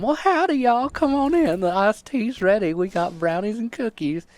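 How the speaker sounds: Southern belle mom. Retold or imitated. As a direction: welcome guests like a sweet Texas hostess. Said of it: "stereotypical Southern belle, you know, Texas mom".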